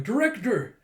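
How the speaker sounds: high, loud call of alarm; alarmed cry. Acted. Yor calls to the knocked-out Director, alarmed cry.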